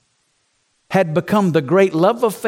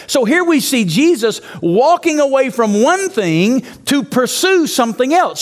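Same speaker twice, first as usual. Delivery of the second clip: normal talking voice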